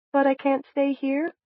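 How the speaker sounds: high, timid question; high, hesitant pleading. A narrator's reading. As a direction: ask in a small high voice, afraid of the answer. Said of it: "Sonny's timid voice asked".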